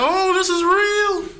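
high, slow and loud panicked cry; mock terror. Audience spotting the sleepwalker, in high loud mock panic. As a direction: scream it like the movie came alive.

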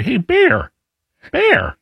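high, sing-song mock hiker call; lightly hammed bear greeting; hey bear call. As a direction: chirp it at the bear.

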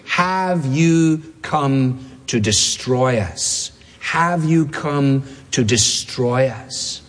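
very slow, measured quoting of a demon; slow demon's question. Retold or imitated. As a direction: space out each word deliberately. Demon's question quoted, said twice slowly.

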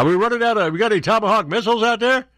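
mock heckler. Host voices heckling reporter.

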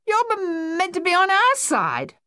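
very high, loud stammered protest; indignant yell. A narrator's reading. Luther protesting to the retreating Australians, stuttering yell. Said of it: "Luther yelled at the retreating men".

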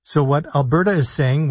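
normal talking voice

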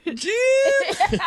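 high silly voice calling Jim; imitating Tony Romo. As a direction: call for Jim, laughing.

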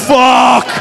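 loud, high mock yell; defiant shout. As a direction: hurl it at each of them. Busboy defiant at coworkers.

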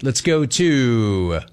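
slow host stretch on go; drawn-out host call. Acted. Host drawing out the call to the next caller. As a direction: drag the words out before the next city.

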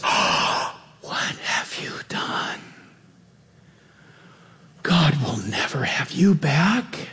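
slow, gasping whisper of mock horror; whispered accusation. Satan turning on the believer he tempted, hushed condemning whisper. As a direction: whisper it as if appalled at them.

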